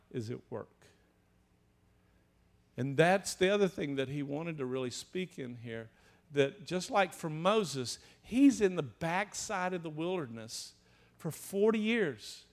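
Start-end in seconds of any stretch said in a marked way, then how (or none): none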